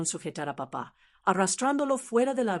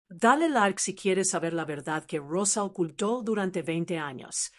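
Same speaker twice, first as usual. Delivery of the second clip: ordinary delivery, nothing marked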